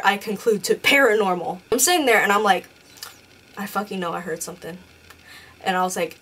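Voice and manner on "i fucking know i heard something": low, hushed, quick mutter to oneself; retold self-talk. Sitting alone after hearing a noise, hushed self-talk. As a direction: say it quietly and fast, as if to yourself.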